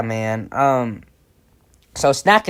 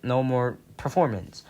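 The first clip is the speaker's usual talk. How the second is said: ordinary delivery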